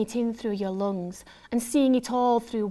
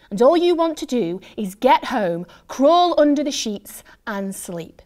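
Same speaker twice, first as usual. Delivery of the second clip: desperate longing; 3 semitones higher, 9 dB louder than usual. A narrator's reading. Hungover fan wanting to get home, desperate wail.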